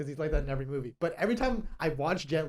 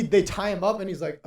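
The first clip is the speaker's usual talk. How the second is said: usual speaking voice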